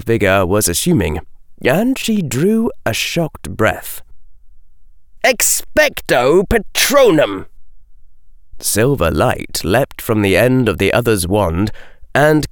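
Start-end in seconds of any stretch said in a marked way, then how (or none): 5.2-7.5 slow, high-pitched incantation; spell incantation. A narrator's reading. Robed figure casting a spell before Daphne, incanting. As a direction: intone it slowly and high like a spell.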